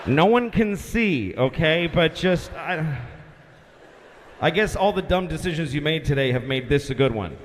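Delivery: mildly fed-up tower guy giving up; indifferent controller. Acted. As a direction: shrug it off with weary pauses. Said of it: "That's how he said it, like".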